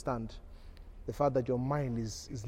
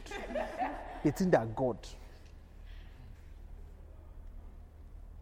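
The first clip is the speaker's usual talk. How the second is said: long breathy laugh; 4 dB quieter than usual. Real, not acted. Preacher laughs at own joke.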